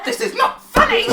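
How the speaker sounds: high, loud, laughing-through rage; furious squeal. Acted. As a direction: shriek it, barely holding the laugh. Gnome boss ranting.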